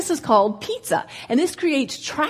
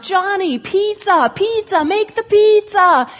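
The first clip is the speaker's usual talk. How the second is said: high, loud yell building to a shout; crying down the slope; 8 semitones higher, 6 dB louder, slower than usual. Retold or imitated. Ski instructor yelling down the slope at a runaway kid, building shout. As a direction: yell louder and higher down the hill at him. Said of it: "as I'm crying down".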